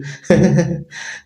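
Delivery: nervous laugh. Acted. Nervous laugh after scheming.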